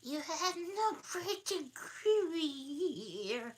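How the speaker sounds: very high, slow squeaky voice; dormouse voice. Acted. Dormouse complaining to Alice, high and slow. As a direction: stretch it out like a tiny creature. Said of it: "said the mouse".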